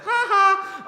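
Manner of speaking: loud, high, jeering "ha ha"; mean-spirited mock laugh. Shown as a demonstration. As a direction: fake a mocking laugh at someone. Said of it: "mean-spirited laughing at someone".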